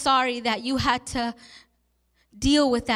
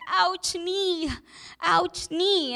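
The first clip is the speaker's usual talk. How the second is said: lightly voiced hurt toddler, pitched higher; imitating crying toddler; 5 semitones higher, much slower than usual. Aunt retelling her nephew's cries over his hurt knee, imitated toddler crying. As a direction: mimic a little one's pained whimper, gently. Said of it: "as he's crying, he's saying".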